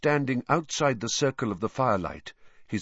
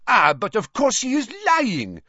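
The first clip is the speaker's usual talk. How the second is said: high, loud accusing cry; cried out; 7 dB louder than usual. A narrator's reading. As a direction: cry it out in a French accent. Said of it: "cried Madame Maxime".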